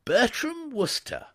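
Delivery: an eager headmistress greeting a guest; Miss Tomlinson's voice. A narrator's reading. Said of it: "she said".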